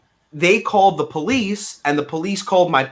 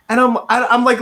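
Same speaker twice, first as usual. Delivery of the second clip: normal talking voice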